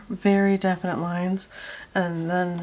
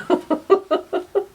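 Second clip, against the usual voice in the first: high, loud burst of laughter; 12 semitones higher, 7 dB louder than usual. Real, not acted. Artist laughing at her own joke, high laugh.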